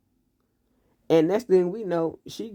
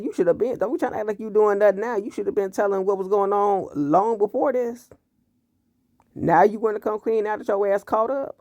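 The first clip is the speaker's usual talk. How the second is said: scolding sass; 6 semitones higher than usual. Real, not acted. Scolding the character while recapping.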